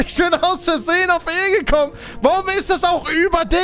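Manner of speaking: high, exasperated whine of complaint; dismayed wailing. Real, not acted. Player blaming the key layout, high exasperated wail. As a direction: wail at your own blunder. Laments accidental key press.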